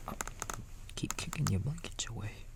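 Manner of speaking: ordinary delivery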